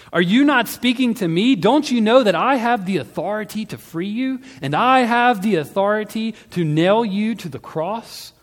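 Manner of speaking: high, loud, indignant demand; angry outburst. A narrator's reading. Pilate threatening silent Jesus with his authority, angry shouting. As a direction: explode at being met with silence.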